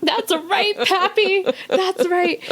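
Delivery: high, loud triumphant chant; triumphant cheer. Retold or imitated. Cheering on Pappy's spirit in triumph. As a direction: cheer it like a victory.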